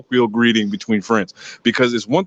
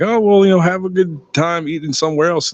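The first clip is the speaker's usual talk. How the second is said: higher, breezy mock send-off; imitating amused family; 7 semitones higher than usual. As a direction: wave them off lightly in a raised pitch.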